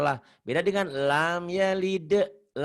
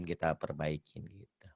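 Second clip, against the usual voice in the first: whisper; 10 semitones lower, 12 dB quieter than usual. Real, not acted. Trailing off to a whisper.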